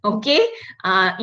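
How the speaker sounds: usual speaking voice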